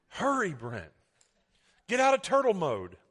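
mock nagging, rising to a loud, high order; imitated impatient wife. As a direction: playfully imitate a wife hurrying her husband. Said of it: "she's had to say this to me".

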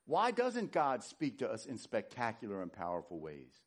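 normal talking voice

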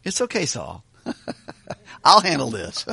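calm little brother waving off the danger, half laughing; casual reassuring David. Pretend. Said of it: "Or another way to say that is".

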